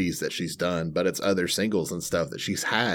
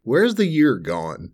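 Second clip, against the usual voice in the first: slow, high, drawn-out disbelief; incredulous; 5 semitones higher, 3 dB louder, slower than usual. Real, not acted. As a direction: marvel at where time went. Disbelief at time passing.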